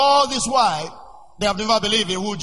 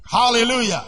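ordinary talk

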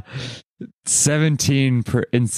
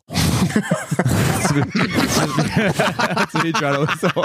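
laughing; 7 semitones higher, much slower than usual. Real, not acted. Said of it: "just horn".

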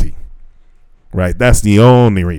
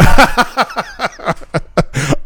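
high laugh; 10 semitones higher than usual. Real, not acted. Laughs at bridesmaid joke.

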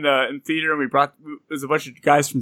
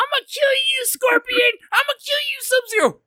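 put-on very high, piercing little kid; squeaky kid voice. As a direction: shriek it like a tiny kid. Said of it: "You have his little kids like".

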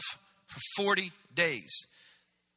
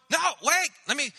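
urgent plea; 6 semitones higher than usual. Retold or imitated. Elisha begging to follow.

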